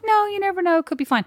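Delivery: mock upbeat friend. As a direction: brush off the worry brightly. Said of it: "She's like".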